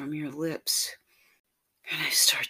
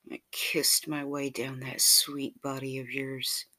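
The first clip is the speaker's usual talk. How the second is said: plain reading voice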